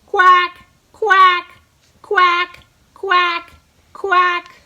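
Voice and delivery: loud, high quacking like a duck; playful duck imitation for the kids; duck quacks. Shown as a demonstration. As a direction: quack out like a mallard. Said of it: "help me do five quacks".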